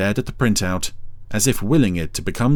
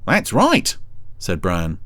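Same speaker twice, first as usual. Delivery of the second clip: high cheeky snail voice; Brian voice; 6 semitones higher than usual. A narrator's reading.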